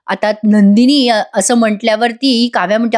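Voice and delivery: ordinary delivery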